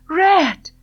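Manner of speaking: high, loud call across the fair; sudden cry after a man walking away. A narrator's reading. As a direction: shout his name to stop him. She bursts out calling him.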